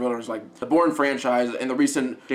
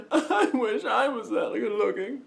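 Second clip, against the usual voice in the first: slow, higher, said through a laugh; 6 semitones higher, 3 dB quieter, slower than usual. Real, not acted. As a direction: laugh your way through the wish. Laughing while admiring actor's looks.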